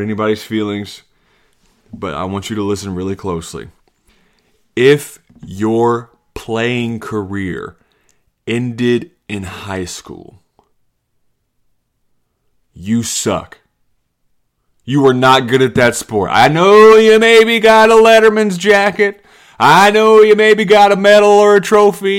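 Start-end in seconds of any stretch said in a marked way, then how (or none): 4.8-13.6 spaced-out words with heavy pauses; slow, deliberate verdict. Real, not acted. Blunt verdict after asking for attention. 16.3-22.2 mock concession. Comedian mocking players' letterman jackets and trophies, loud taunt.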